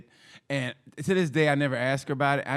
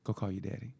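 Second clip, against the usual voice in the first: quiet menacing mom; 5 semitones lower, 9 dB quieter than usual. Retold or imitated.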